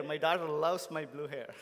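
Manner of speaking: normal talking voice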